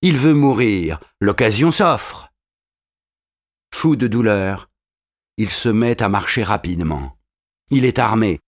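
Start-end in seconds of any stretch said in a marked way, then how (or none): none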